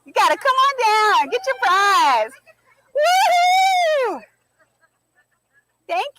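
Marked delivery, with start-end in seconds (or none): 0.1-4.2 loud, high game show call; booming prize announcer. Acted. Calling winner up.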